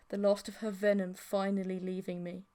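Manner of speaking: ordinary delivery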